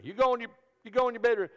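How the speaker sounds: high, fast fatherly command; hammed-up dad shooing a kid; fast mock order. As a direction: hurry him off to bed. Father ordering son to bed.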